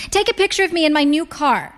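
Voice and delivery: high-pitched, delighted mother's voice; excited mother. A narrator's reading. Mother handing daughter her phone, excited. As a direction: pitch it up, showing off to your daughter.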